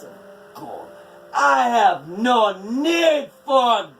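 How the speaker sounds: loud, high, defiant refusal; angry giantess. A narrator's reading. Skadi rejecting Odin's gold, loud and furious. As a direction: spit it back in contempt.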